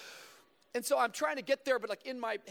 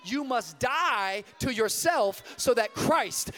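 louder, slow preaching climax; building preaching; 9 dB louder than usual. Acted. Preacher closes by urging the crowd, louder and slow. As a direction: drive it home, slow and strong.

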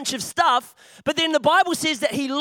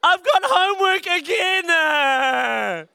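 mock whine; 5 semitones higher, much slower than usual. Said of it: "We're like".

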